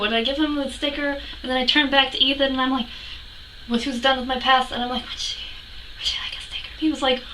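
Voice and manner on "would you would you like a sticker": soft, halting shy offer; hushed, hesitant retold question; timid whisper. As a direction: whisper it as a starstruck fan. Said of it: "I was really quiet".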